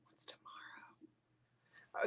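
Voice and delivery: slow breathy whisper of "What's tomorrow?". Acted. Host whispering the question back to herself after asking Deanna, slow whisper. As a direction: drop to a hushed, slow whisper as if sharing a secret.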